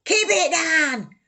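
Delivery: high, loud shout from a window; neighbour shouting. Retold or imitated. A neighbour yelling out the window at the noise, loud shout. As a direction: yell down at the noise. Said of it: "somebody shouting".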